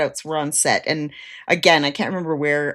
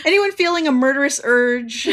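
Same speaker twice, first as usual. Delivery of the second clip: higher, louder mock appeal; hammed-up public inquiry, pitched up; imitated calling out; 7 semitones higher, 5 dB louder than usual. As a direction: call it out to anyone around. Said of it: "He's like".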